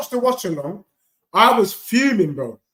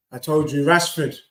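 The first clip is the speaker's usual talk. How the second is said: normal talking voice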